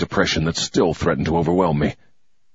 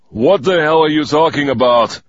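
gruff, louder grumble; a tired, irritated growl; grumbled; 7 semitones higher, 5 dB louder than usual. Acted. Tired major grumbles at the stranger, gruff. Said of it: "he grumbled".

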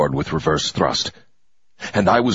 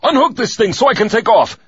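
much higher, louder command; urgent order; 14 semitones higher, 5 dB louder than usual. Acted. Fake pilot orders the mechanics, shouting. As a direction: yell the order, no time to waste.

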